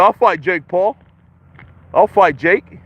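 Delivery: ordinary talk, nothing marked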